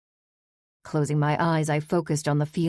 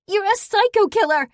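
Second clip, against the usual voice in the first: much higher, loud scream; screaming accusation; 8 dB louder than usual. Acted. Daughter screams at the parolee, high furious scream. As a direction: scream it with eyes bulging. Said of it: "she screamed".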